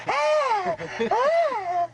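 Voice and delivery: high imitated yelps of alarm, twice; panicked yelp. Retelling the kid's panic at the gas pump, high mock yelps. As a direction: mimic the kid's shocked cry at the pump. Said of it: "he went".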